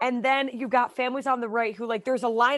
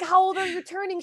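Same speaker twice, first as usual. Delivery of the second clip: put-on talking to a child; 8 semitones higher, 3 dB louder than usual.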